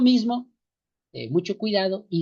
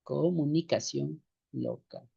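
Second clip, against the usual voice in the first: slow and softer, word by word; slow dictation; 2 semitones lower, 6 dB quieter, slower than usual. Shown as a demonstration. Teacher repeats the definition for copying, slow and soft. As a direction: dictate it gently for note-taking.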